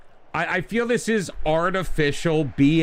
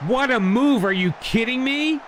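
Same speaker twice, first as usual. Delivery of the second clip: high, incredulous scoff; mock the blunder in disbelief; sarcastic disbelief; 10 semitones higher, 4 dB louder than usual. Real, not acted. Mocking a player's blunder.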